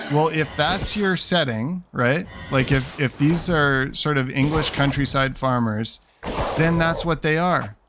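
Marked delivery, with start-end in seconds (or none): none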